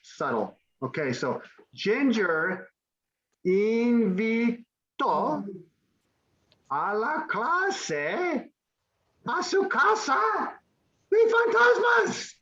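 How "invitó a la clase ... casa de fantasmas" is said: ramp up the suspense to "de fantasmas"; dramatic build. Acted. Teacher dramatizing ghost house story.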